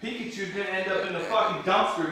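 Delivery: low, soft, quick grumble; muttered threat. Real, not acted. Streamer threatens girl's game, low fast mutter. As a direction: grumble the threat under your breath.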